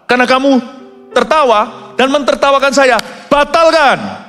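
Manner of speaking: mock offended God. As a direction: play an affronted God calling it off. Imagined God cancelling the promise.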